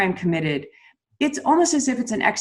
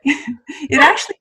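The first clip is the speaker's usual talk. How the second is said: laughing; 5 semitones higher than usual. Real, not acted. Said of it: "That is so not you".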